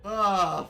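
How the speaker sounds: sharp loud cry of pain; pained yelp. Real, not acted. Gamer bitten in game, loud pained yelp. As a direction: yelp as the hit lands. Hurt by enemy dog.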